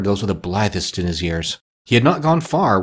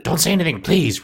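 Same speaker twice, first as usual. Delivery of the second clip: higher-pitched, insistent retort; quick retort; 6 semitones higher than usual. A narrator's reading. Scrooge stopping the gentleman's thanks, quick retort. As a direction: cut off the thanks. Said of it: "retorted Scrooge".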